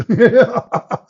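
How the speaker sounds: high burst of laughter; real laughter. Real, not acted. Cracking up over his own mockery, high laughter. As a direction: burst out laughing mid-explanation.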